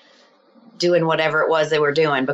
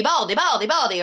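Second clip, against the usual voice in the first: lightly imitated repeated blurt, higher-pitched; imitated Tourette's tic; 6 semitones higher than usual. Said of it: "she's, like, blurting stuff".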